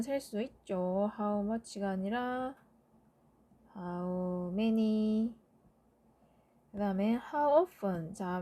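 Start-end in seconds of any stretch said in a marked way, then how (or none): 3.8-5.3 slow dictation. Shown as a demonstration. Teacher stating correct form slowly.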